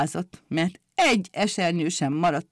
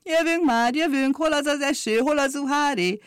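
eager umbrellas; 7 semitones higher than usual. Acted. Umbrellas rushing to the rain.

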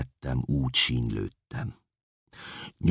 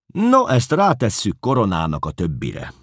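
pleased; 7 semitones higher, 7 dB louder than usual. Acted. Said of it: "szóltam elégedetten".

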